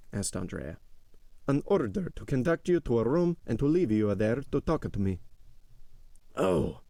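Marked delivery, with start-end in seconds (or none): 1.5-5.2 stern steward, pitched higher than the narration; Bertuccio. A narrator's reading. Steward's character voice. 6.4-6.8 higher-pitched cry of delight; joyful cry. A narrator's reading. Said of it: "cried Andrea, leaping with joy".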